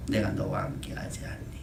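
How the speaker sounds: God softly reminding the congregation he is near; gentle promise. Acted. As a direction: lean in and reassure quietly. God's promise repeated softly.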